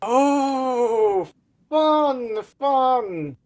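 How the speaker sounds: high, sing-song gloating; mock delight. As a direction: relish the player's bad luck. GM gloating at failed roll.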